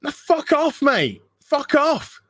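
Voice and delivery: high, sharp fuck off; mock dismissal. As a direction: wave them away with a high jeer. Dismissing the preachy brands.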